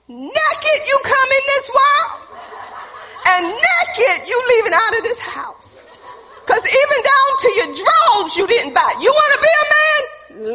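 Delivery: full-force, hammed-up parental outrage; retold furious tirade. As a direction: yell it like a mother throwing her son out. Enraged mother throwing son out.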